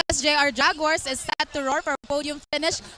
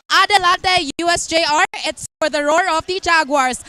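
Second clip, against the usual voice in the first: louder rallying cry for the Jaguars; rally the crowd, full voice; team cheer; 5 dB louder than usual. Acted.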